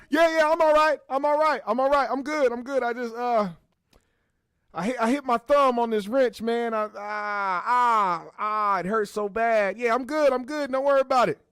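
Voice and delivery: high, loud, nervous reassurance; flustered cover-up. Retold or imitated. Plumber hiding the cash, high and loud, faking a hurt thumb. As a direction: cover up fast and fake the pain.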